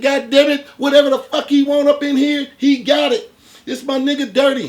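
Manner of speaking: high, loud, laughing hype-man greeting; loud fake-friendly greeting. To the armed man, overjoyed fake-friend shout. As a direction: greet him like a long-lost buddy, way up high. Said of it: "I was still in Jeff mode".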